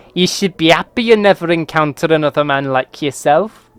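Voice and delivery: hammed-up Scottish raven scorn; scary raven Scottish accent. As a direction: sneer it in a bad Scottish accent. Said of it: "the worst Scottish and Northern accents".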